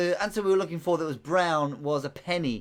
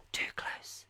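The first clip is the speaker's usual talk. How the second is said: whispered, close to the microphone; 11 dB quieter than usual. Shown as a demonstration. Quizmaster too near the microphone, whispering. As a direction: say it in a hushed whisper. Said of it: "I should probably whisper".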